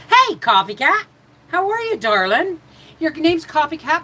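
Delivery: cheery greeting. Real, not acted. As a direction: greet the viewer warmly and brightly. Greets a viewer in live chat.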